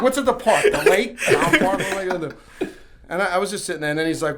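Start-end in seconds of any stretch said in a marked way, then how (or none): 0.0-2.7 put-on high, loud, laughing kid protest; laughing whine. Twelve-year-old pushing back at dad, high and laughing.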